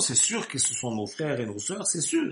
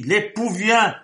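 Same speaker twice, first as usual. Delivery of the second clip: loud proclamation; 7 semitones higher, 9 dB louder, slower than usual. Acted. Preacher crying out the herald's call to the sleepers, loud and high.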